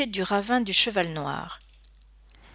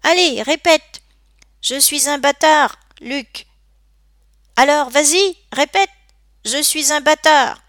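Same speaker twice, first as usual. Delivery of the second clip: high, loud goading bully; taunting command; 7 semitones higher, 7 dB louder than usual. A narrator's reading. Bully ordering the boy to insult himself, taunting. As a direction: push him to repeat it, loudly.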